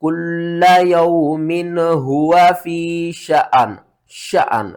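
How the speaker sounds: slow melodic tajweed recitation; Quran recitation. Shown as a demonstration. Teacher recites the verse correctly, slow and melodic. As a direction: chant it out like a reciter. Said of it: "किस तरह?".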